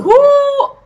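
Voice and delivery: loud, high whoop; high "whoo". Real, not acted. Vlogger bracing before things get worse, high whoop. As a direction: let out one piercing whoop.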